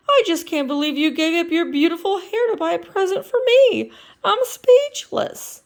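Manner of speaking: high, loud, moved frog; a touched boyfriend lost for words; Kermit voice. Acted. Kermit voice in the story.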